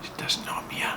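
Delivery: hushed secret whisper. Real, not acted. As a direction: whisper so she can't hear. Whispering so wife won't hear.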